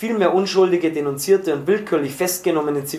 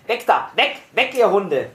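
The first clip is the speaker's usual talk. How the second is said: sharp, higher-pitched soldier's yell; soldier's shout; 4 semitones higher than usual. A narrator's reading. Soldier aiming his rifle yells up at prisoners, shouting. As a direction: shout up a warning in short bursts. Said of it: "schrie anlegend herauf".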